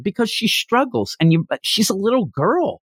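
ordinary talk, nothing marked

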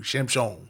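Shimshon said the Hebrew way, SH-im-SHON with sh sounds; Hebrew name. Shown as a demonstration. Pastor showing the congregation Samson's Hebrew name Shimshon. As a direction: say Shimshon like the Hebrew, sh at both syllables. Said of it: "Shimshon is what it is in Hebrew".

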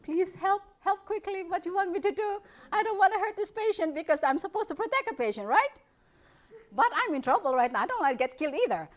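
mock alarm, rushing the prayer; retold panicked prayer. Nurse praying for help facing a huge patient, mock panicked plea. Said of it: "I said".

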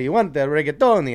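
mock Italian accent. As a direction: mimic an Italian accent, poking fun. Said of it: "start making fun of their accent".

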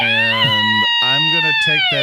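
high excited yell; excited shout. Real, not acted. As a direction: shout it out gleefully. Hero points cheer.

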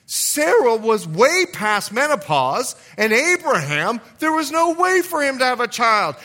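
high, loud preacher's climb, drawn out on key words; preacher raising his voice to the church; preaching at full force. Acted. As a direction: build the fire of the sermon.

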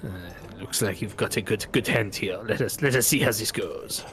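quiet, sly foreign gambler sizing up the hand; Bretonnian accent. Acted. Gambler eyes the player's good hand, soft Bretonnian accent. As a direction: murmur it like a confident card player.

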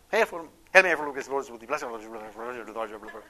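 mumbled, careless rosary; telegraphic rosary. Retold or imitated. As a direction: rattle "Hail Mary, full of grace" off without meaning it. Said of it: "if they heard you say the rosary this way".

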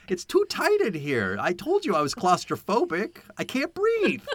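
put-on high-pitched childish whining, climbing at the end; Charles Grodin. Mimicking a claustrophobic man complaining in a bathroom, childlike whine. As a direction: whine like a trapped child, hammed-up. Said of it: "whine and complain".

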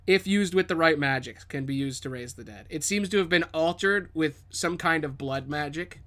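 normal talking voice

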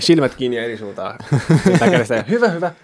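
high, eager mock cheering of a husband; hammed-up husband cheering his wife on to push; mock birth coach. Husband mockingly cheering the wife in labour, high and eager.

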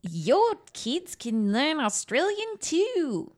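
a mild, high, slow ad-announcer impression; bad commercial voice. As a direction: sell it like an old language-course ad, hammed-up. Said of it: "Sorry, that was terrible".

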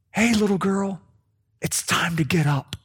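put-on hushed, tender near-whisper to a sleeping child; gently waking someone. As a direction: softly coax her awake, trailing into a whisper. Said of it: "talk to her like she was asleep".

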